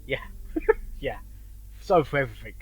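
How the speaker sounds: ordinary talk, nothing marked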